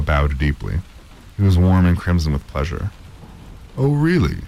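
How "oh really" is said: ordinary delivery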